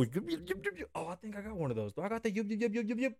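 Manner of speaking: high, breathy little yips; yappy panting puppy. Pretend. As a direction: yip like an excited puppy. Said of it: "So I'm gonna go".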